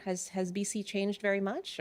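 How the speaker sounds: ordinary talk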